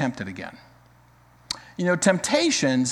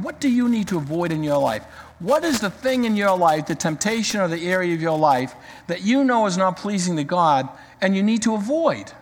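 ordinary talk, nothing marked

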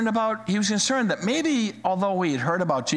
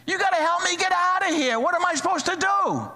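panicked man begging; 12 semitones higher than usual. Retold or imitated. Dangling man begs Jesus to pull him up, high and frantic. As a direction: beg for rescue in a squeaky panic. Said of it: "And the guy looks up and says".

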